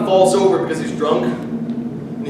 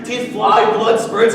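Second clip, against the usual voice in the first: ordinary talk, nothing marked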